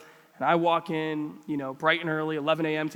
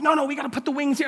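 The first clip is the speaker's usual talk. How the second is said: quick, higher fussing; over-involved dad; 7 semitones higher, much faster than usual. Retold or imitated. Dad takes over kid's Lego build, mock fussing. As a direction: imitate a parent eagerly taking over.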